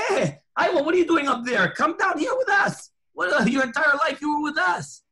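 loud, high call up to heaven; calling up loudly. Retold or imitated. Friends shouting up to him in Gan Eden, loud call. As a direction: shout up to a friend far above.

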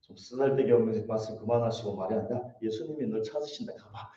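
mock a grudging, dismissive errand. Martha sends her sister off to Jesus, dismissively. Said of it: "이렇게 얘기했던".

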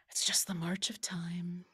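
soft breathy whisper, wistful. Real, not acted. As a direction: whisper it like a sigh. Wistful repeat of earlier line.

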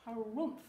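loud gruff harumph; a stubborn walrus grunt. Acted. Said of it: "said Walrus".

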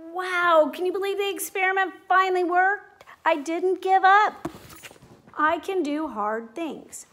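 excited. Pretend. Celebrates the experiment working.

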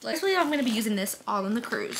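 usual speaking voice

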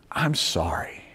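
hushed retold apology; quiet, contrite mother's words; soft apology. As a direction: drop the volume and apologise tenderly. Mother imagined apologising to her son.

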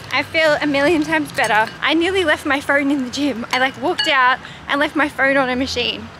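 loud, high post-gym excitement; energized after the gym; buzzing. Real, not acted.

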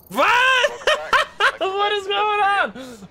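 high shocked cry; laughing disbelief, way up high; shocked shriek. Real, not acted. Surprise double ghost result.

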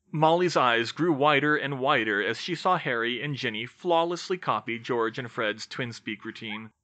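usual speaking voice

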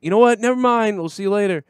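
high, loud mock backing-down voice; mock scared neighbor. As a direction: play the neighbor suddenly backing off. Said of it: "and they'll be like".

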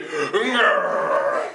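long, committed flexing growl; full-on growl. Shown as a demonstration. Leader shows how it's gotta be, full-on growl. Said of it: "It's gotta be".

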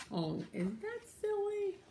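high, light reaction; playful amusement. Real, not acted. Teacher reacting to the cow's mistake, high and playful. Reader reacts to silly cow.

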